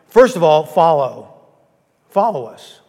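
clear and strong. Real, not acted. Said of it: "Is that better?".

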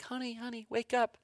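soft, hushed honey wake up; nudging a sleeping spouse, quietly; gentle wake-up. Shown as a demonstration. Spouse softly waking partner, gentle hush. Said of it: "you do this to your spouse".

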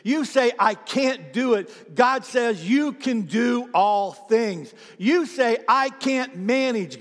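loud, high, driving call-and-response; preaching at full force. Acted. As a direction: preach it hard, building each promise.

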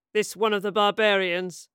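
loud, brusque demand; officer's bark. A narrator's reading. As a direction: question like a commanding officer. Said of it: "Quintus barked".

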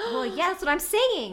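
high, loud, thrilled yell; excited. Real, not acted. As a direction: shout it out in delight. Excited shouting over the idea.

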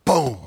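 one loud, sudden "boom"; gavel bang. Retold or imitated. Preacher mimicking the judge's gavel, a single punchy boom. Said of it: "then he took out the gavel".

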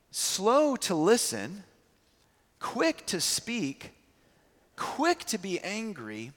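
slow, spaced out phrases; deliberate emphasis. Real, not acted. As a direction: lay each phrase down slowly. Preaching list from James.

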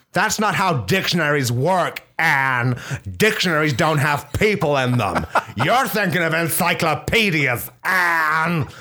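high, laughing put-down; condescending lecture. Pretend. Correcting her partner, high and condescending with laughter. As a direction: talk down to her with a giggle.

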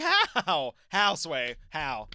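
high, loud, drawn-out incredulity; mock disbelief. Diner baffled by the speedy waiter, exaggerated disbelief. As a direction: play up being utterly baffled. Retelling disbelief at fast service.

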